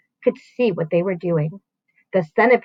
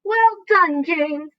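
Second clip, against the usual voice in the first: delighted praise; 7 semitones higher, much slower than usual. A narrator's reading. Grasshopper praising.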